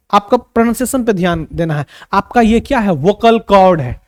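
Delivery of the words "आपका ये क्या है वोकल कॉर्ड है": usual speaking voice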